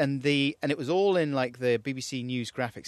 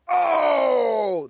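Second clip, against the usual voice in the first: mock stadium roar, high and loud; crowd cheer; 12 semitones higher, 10 dB louder than usual. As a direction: shout it like a whole crowd. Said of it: "the main cheers I've heard".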